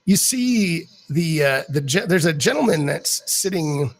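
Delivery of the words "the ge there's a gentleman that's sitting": ordinary delivery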